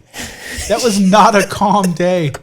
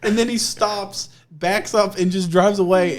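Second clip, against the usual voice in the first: ordinary talk, nothing marked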